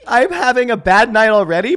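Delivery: laughing. Real, not acted. Still laughing.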